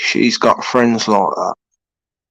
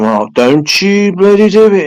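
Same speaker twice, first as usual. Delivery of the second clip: higher and louder warning at the cat; mock scolding; 8 semitones higher, 5 dB louder than usual. Warning the cat near the window ledge, loud mock scold. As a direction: warn the cat off, loud and sharp.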